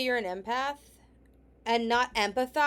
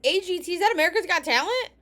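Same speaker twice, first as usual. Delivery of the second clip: higher, louder and fast, can't believe it; incredulous disbelief; 7 semitones higher, 8 dB louder, faster than usual. Real, not acted. Reacting to a mom crying over a TV show, incredulous and fast. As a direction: pile the questions on, more outraged each time.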